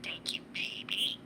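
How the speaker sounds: whispery little bird voice copying words; chirpy talking budgie; parakeet chatter. Real, not acted. Parakeet echoes owner.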